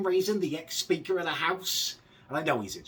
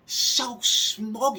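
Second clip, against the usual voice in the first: mock smug; 8 semitones higher, 6 dB louder than usual. Mocking Bercow.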